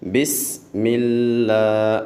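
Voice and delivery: slow بسم اللہ with a long hissing س; whistled س. Shown as a demonstration. Teacher repeating the correct whistled س. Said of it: "سیٹی پیدا کرنی ہے".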